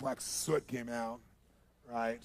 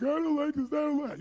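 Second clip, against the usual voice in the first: high imitated wail; hammed-up tearful complaint; freaked-out whine; 13 semitones higher, 5 dB louder than usual. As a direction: whine it in mock distress. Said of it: "he's freaking out of his mind".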